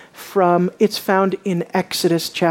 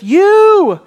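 a loud, stretched YOU that swoops up high; emphatic YOU; 10 semitones higher, 6 dB louder than usual. Shown as a demonstration. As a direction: throw all the weight on YOU. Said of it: "an emphatic you".

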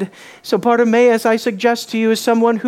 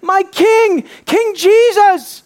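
high, loud, drawn-out hail; soaring, emphatic proclamation; shouted acclamation; 10 semitones higher, 6 dB louder, much slower than usual. Acted. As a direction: cry out to your king with total conviction. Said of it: "He's saying".

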